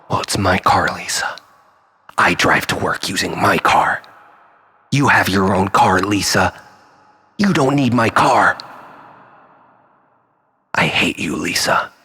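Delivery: angry. Acted. Fighting over the car.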